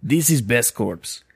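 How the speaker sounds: thick mock Russian accent, slow; slow, hammy Russian salesman voice. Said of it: "That's the most Russian I can do".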